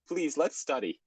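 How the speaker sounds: high, pleading character voice; nervous Tails. A narrator's reading. Tails pushing Cream away to study, nervous. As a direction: plead nervously, pitched well up. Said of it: "because he was nervous".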